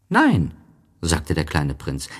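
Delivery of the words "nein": put-on high childlike story voice; voiced little prince, lifted well up. A narrator's reading. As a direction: pitch it high for a small boy.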